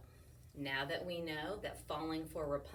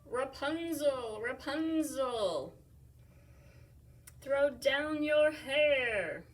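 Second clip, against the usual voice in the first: higher, slow, loud calling up the tower; prince calling up; 6 semitones higher, 10 dB louder, much slower than usual. Acted. Prince calling up to Rapunzel in the tower. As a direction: stretch it out to reach the window. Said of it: "said the prince".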